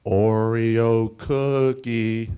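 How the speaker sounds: loud taunt. Retold or imitated. Kids on the playground taunting him, slow loud jeer. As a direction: call out Oreo cookie like a schoolyard bully. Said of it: "That's what I heard on the playground".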